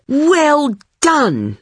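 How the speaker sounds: high, drawn-out praise; impressed congratulation. A narrator's reading. Narrator to friend with a pet spider, impressed congratulation. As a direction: praise a friend with delighted admiration.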